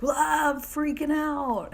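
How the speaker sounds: high, loud, drawn-out mock panic; mock freak-out. Vlogger acting out people panicking at her, mock freak-out. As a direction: imitate someone losing it, stretched and high. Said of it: "So now people are like".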